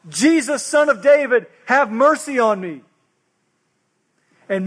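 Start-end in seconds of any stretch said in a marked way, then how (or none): 0.1-2.8 raised, higher, louder plea for mercy; crying out. Acted. Blind beggar calling to Jesus on the roadside, crying out. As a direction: call out over a crowd to be heard. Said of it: "he began to cry out".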